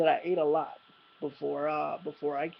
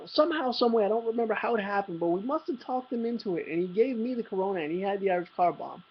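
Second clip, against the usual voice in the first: ordinary delivery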